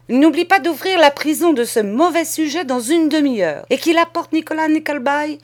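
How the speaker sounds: high, loud, bossy mistress; cousine autoritaire. A narrator's reading. Cross cousin orders the servant about. (maîtresse autoritaire, voix haute et aigre)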